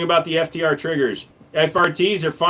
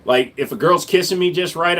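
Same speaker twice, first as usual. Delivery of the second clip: usual speaking voice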